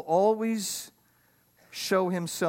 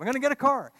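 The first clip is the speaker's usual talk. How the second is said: put-on higher, eager young man's thought; excited; 7 semitones higher, much faster than usual. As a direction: gush about buying a dream car. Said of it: "Very impulsive".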